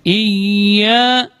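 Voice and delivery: "Iya" with a held double y; "Iya" pronounced "iy-ya"; clear tasydid. Shown as a demonstration. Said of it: "Jelas bunyi tasydidnya".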